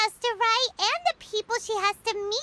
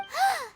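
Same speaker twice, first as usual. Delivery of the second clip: a quick, sharp gasp of alarm; shocked gasp; 8 semitones higher than usual. Acted. Friend hears Kokomi is not feeling well, alarmed gasp. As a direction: catch your breath in shock.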